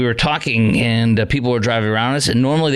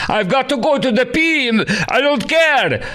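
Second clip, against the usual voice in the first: much higher put-on woman's voice, laughing through it; mock passenger with accent; 13 semitones higher, slower than usual. Host mimics the woman on the plane, high and hammed-up. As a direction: ham up the stranded passenger's strange voice. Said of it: "woman with, like, a deep, uh, accent".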